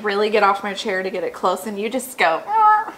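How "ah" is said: Canadian "Ah" with a sharp high leap; a jokey Canadian "Ah" sound; Canadian accent. Shown as a demonstration. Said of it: "Canadian accent".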